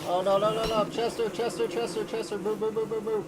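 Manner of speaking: high, loud panicked yelling; shouting orders over battle. Real, not acted. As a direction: shout at him to move. Panicked shouting during fight.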